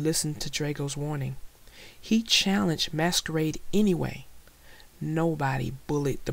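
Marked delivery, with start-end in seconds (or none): none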